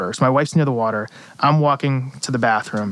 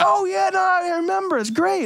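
much higher, hammed-up "oh yeah, I remember" that fakes knowing him; mock fake recognition. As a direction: overplay the celebrity pretending he remembers you. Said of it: "he doesn't know who the hell I am".

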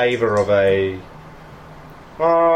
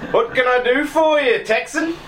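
put-on much higher, sly old thief greeting; Dougie, old Cockney thief; 11 semitones higher than usual. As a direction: pitch it way up as the wily old fence. GM back in Dougie's voice.

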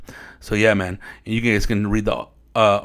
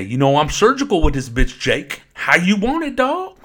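a cocky street cop, rising much higher at the end; Denzel Washington impression; 7 semitones higher than usual. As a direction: swagger it like a crooked cop taunting his partner. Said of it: "like Denzel Washington in, uh, in Training Day".

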